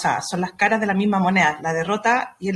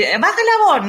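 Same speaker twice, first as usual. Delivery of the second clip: high, louder mock reprimand to a child; mock scolding; 9 semitones higher, 4 dB louder than usual. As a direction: snap back at the kid, half-acted. Parent scolding a shouting child.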